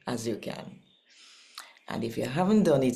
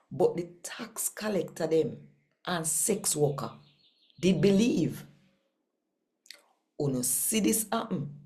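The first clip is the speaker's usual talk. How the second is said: storytelling read of a parable in Jamaican Creole; scripture reading; 2 semitones higher than usual. Acted. Host reading a Jamaican parable aloud to viewers, storyteller style. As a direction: read the Bible story to listeners, lightly voicing its figures.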